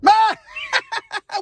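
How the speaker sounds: high, loud mocking cackle; burst of laughter. Inmates react to the Red Lobster plan, high burst of laughter. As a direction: crack up at a ridiculous idea. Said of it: "We start laughing".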